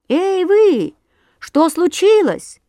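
high, loud call across the river; chimney sweep hails the birds loudly. A narrator's reading. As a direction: shout to the birds over the noise. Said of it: "крикнул трубочист".

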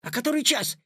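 high, startled question; boy suddenly scared of being late; alarmed. A narrator's reading. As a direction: pitch jumping in worry. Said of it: "испугался Петя".

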